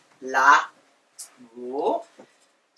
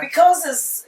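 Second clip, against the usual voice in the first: excited; 11 semitones higher, 5 dB louder, slower than usual. Real, not acted. Excited build-up to the reveal.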